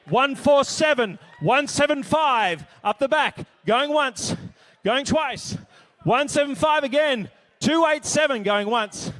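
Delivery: loud, rapid-fire auction call; auctioneer calling bids. Acted. Auctioneer at a charity gala, calling out bids loudly.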